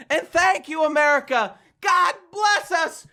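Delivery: high, building to a drawn-out cry; hammed-up grand closing blessing; rousing patriotic finale. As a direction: proclaim it to the whole crowd, stretching the last word. Climax of mock patriotic monologue.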